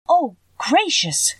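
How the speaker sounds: high, slow astonished gasp of words; a girl stunned by a witch; drawn-out high wonder; surprised cry. A narrator's reading. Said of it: "cried Dorothy".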